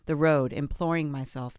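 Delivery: ordinary delivery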